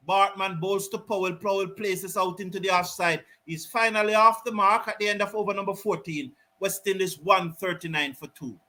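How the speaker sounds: calm live cricket commentary; play-by-play. Real, not acted. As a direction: call the delivery as it happens.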